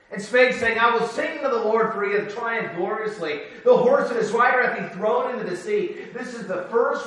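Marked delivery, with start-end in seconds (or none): 0.1-6.0 raised, loud preaching read of scripture; ringing pulpit reading of the Bible. Acted. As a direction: proclaim the verse high and loud. Reads scripture aloud in preaching.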